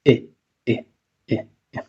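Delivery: short open e sound, e e. Shown as a demonstration. Teacher modelling the vowel, open short e. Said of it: "decimos con la boca un poco más abierta".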